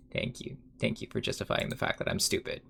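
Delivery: breathy sarcastic whisper; whispered sarcastic thanks; whispered mock thanks. Real, not acted. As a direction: whisper it back with dry sarcasm.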